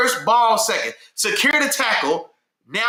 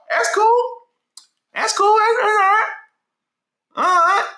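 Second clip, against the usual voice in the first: mock offended; 10 semitones higher than usual. Real, not acted. Teased by chat, mock hurt.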